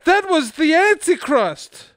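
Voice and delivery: high, loud, drawn-out wail; anguished cry. Acted. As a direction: cry out slowly in horror.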